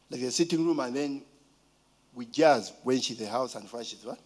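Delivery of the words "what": usual speaking voice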